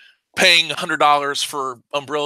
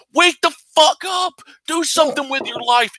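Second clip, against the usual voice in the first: exasperated yell; 13 semitones higher than usual. Real, not acted. Exhorting apathetic creators.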